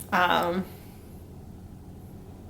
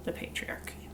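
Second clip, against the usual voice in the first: dramatic whisper; 12 dB quieter than usual. Pretend. Dramatic aside on patriarchy.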